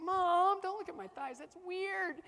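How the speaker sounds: high, loud embarrassed protest; embarrassed teen whine. Retold or imitated. Teen son protesting to mom about his thighs. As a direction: squeal it like a mortified kid. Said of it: "it's like".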